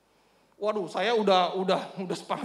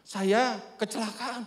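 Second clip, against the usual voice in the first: slow, drawn-out admission; retold subdued confession; slower than usual. Injured neighbour telling the preacher of his accident, slow and subdued. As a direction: quote an injured man slowly breaking the news.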